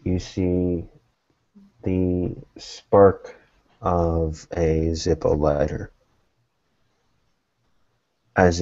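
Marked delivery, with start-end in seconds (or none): none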